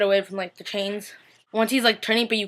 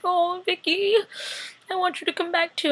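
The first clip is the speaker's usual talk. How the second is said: crying; 9 semitones higher than usual. Retold or imitated. Viewer wants Vicky back, high tearful whine. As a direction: whimper it longingly, on the verge of tears. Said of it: "crying at this point".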